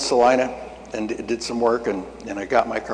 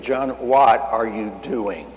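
slow, deliberate questioning; voice of God; slower than usual. Retold or imitated. God questions the preacher by the phone, slow and deliberate. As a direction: draw the question out with patient authority. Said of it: "God spoke to me and said".

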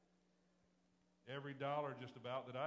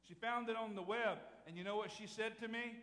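raised, emphatic pulpit voice; preaching loud; 8 semitones higher, 7 dB louder than usual. Acted. Pastor telling his church the car story, loud and emphatic. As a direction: drive it home to the congregation.

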